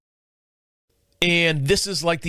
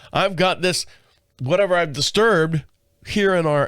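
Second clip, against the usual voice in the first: ordinary talk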